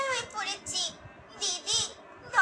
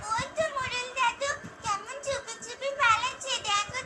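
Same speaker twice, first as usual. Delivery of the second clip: ordinary delivery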